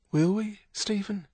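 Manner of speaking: a woman's high plea for reassurance; doubtful Kate. A narrator's reading. Kate doubts Stephen's promise, high questioning voice.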